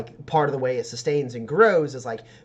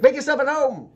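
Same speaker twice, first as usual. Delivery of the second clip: high character voice, words slightly run together; a little mumbled; 12 semitones higher, 5 dB louder than usual. Acted. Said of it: "like, a little mumbled".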